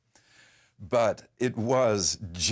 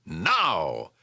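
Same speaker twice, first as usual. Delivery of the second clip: re-enacted yell. Actor re-enacting his cue to start the muskets, yelled. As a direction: give the battle cue as a short mock yell. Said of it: "I was to yell".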